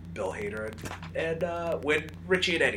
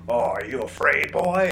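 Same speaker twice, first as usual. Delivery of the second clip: creepy lumberjack taunt; 3 semitones higher, 9 dB louder than usual. Retold or imitated. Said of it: "And then he was like".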